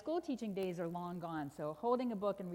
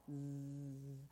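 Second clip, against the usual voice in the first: low, soft buzzing snore; imitated snore; 7 semitones lower, 9 dB quieter than usual. Storyteller voices sleeping lion, droning snore. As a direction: drone like a sleeper.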